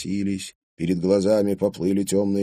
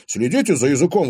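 quick, loud, higher-pitched retort; indignant; 8 semitones higher, 6 dB louder, faster than usual. A narrator's reading. Boyfriend objects to being called a pup, indignant. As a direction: object to the insult. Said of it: "возмутился Клим".

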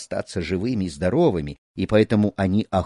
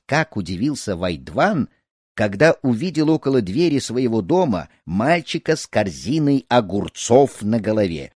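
usual speaking voice

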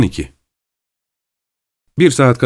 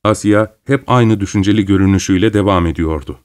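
ordinary talk

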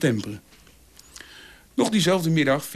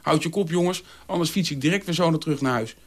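plain reading voice

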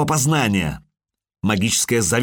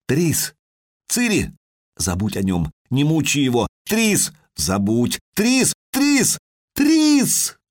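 urgent calling; 5 semitones higher, slower than usual. Acted. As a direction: call her back ever more desperately. Крики чаек, зов Трис. (всё более отчаянный зов)

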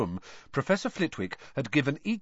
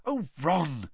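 higher-pitched, mildly skeptical reproach; 7 semitones higher than usual. A narrator's reading. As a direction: doubt him gently, pitch raised. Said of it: "shaking her head skeptically".

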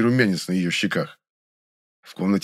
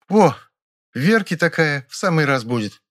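smug; 7 semitones higher than usual. A narrator's reading. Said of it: "с довольным видом хмыкнула".

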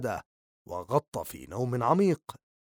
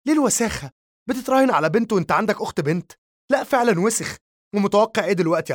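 much higher, fast furious scolding; scolding yell; 6 semitones higher, faster than usual. A narrator's reading. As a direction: tear into her brother at full pitch. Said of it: "بدأت في توبيخه والصراخ فيه".

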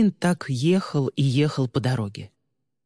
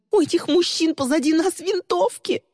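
very high, terrified warning; panicked scream; 3 dB louder than usual. A narrator's reading. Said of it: "закричала Ли". (истошный крик)

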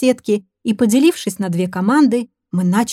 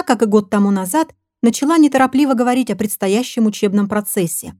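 ordinary delivery, nothing marked

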